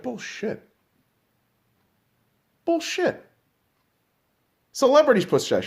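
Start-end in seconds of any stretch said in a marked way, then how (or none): 0.0-0.6 muttered scoff. Real, not acted. Podcaster scoffing at the government line, muttered. 2.6-3.2 higher pitched incredulous bullshit; high scoff. Real, not acted. Podcaster repeating his scoff, high and incredulous.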